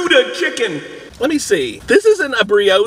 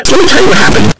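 frustrated outburst; 6 semitones higher, 13 dB louder, much faster than usual. Real, not acted. Stumbling, frustrated retelling.

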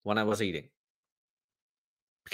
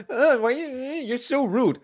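mock offended friend; 11 semitones higher than usual. Friend refused a bite of food, high offended whine. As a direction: whinge it in a high mocking voice. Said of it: "And then they're like".

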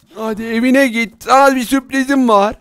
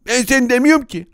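ordinary talk, nothing marked

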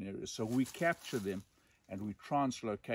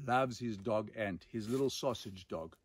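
usual speaking voice

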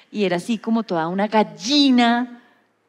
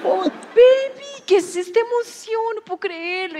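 gushing excitement; 13 semitones higher than usual. Retold or imitated. Friend reunites with her makeup artist, gushing high excitement. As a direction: squeal with joy at an old friend. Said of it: "Entonces yo le dije".

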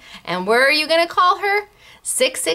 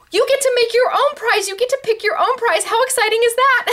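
higher, eager pitch; excited; 10 semitones higher than usual. Real, not acted. Host hyping the gift certificate prize, excited. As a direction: sell the thrill to viewers.

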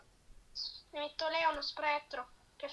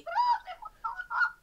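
alarmed; 6 dB louder than usual. Real, not acted. Enemy spotted.

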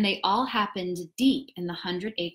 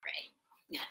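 whisper; 19 dB quieter than usual. Real, not acted. Whispered aside before reading.

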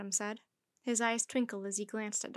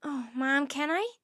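eager; 3 semitones higher, 4 dB louder than usual. Acted. Mandy thrilled by ad idea.

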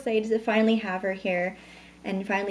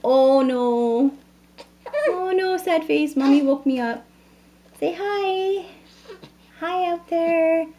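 put-on much higher cooing voice for the baby; baby talk; 7 semitones higher than usual. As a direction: speak for the baby as if she just woke. Mother speaks for sleepy baby.

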